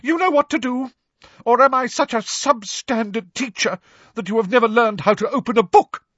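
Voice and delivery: shrill, wounded teacher rant; very high, rising toward loud hysteria; high-pitched hysterical. A narrator's reading. Professor Trelawney berating her class, hysterical. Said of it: "her voice high-pitched and somewhat hysterical".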